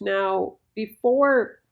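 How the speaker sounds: normal talking voice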